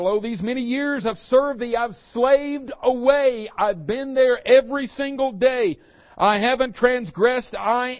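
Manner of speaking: elder son complaining loudly to father; aggrieved elder son. Retold or imitated. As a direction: throw the grievance at father. Elder son's complaint quoted.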